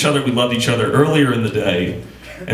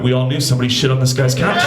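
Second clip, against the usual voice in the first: usual speaking voice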